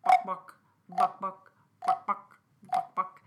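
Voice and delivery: loud, punchy buck buck clucking; loud playful chicken clucking; loud chicken clucks. Shown as a demonstration. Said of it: "and say".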